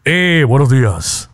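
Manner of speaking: slow, low mock-seductive greeting; hammed-up smooth talker; put-on deep voice. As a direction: drop the voice and draw out the hello. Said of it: "Con ese tono de voz".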